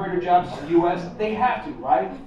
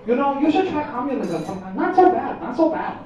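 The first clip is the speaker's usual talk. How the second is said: mock Chinese accent; 5 semitones higher than usual. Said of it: "It's giving me political advice".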